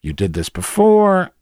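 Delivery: high, slow, hammed-up accusation; retold exasperated complaint. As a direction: stretch out the accusing words. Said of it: "I said".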